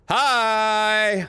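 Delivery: loud, high, drawn-out hello; hammed-up greeting. Player greets a man absorbed in work, exaggerated eager greeting. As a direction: call out a long, eager mock hello.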